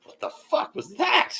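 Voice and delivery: high, slow stunned exclamation; shocked onlookers. Retold or imitated. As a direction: gasp at the outburst in disbelief. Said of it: "they were like".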